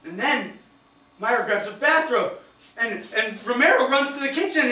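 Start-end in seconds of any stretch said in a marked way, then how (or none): none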